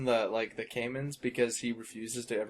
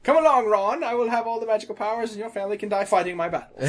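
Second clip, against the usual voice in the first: mock grand declaration; 11 semitones higher, 9 dB louder than usual. Mock quote of J K Rowling.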